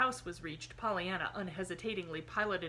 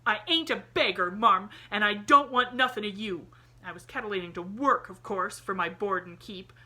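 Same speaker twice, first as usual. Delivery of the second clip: put-on much louder, indignant street boy; defiant boy; 3 semitones higher, 10 dB louder than usual. A narrator's reading. Jimmy confronting Miss Polly that he is no beggar, much louder. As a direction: stand up for yourself proudly.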